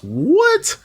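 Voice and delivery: high, rising yelp of disbelief; incredulous. Real, not acted. Streamer reacting to ants in her cup, incredulous. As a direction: shoot up high in sheer disbelief.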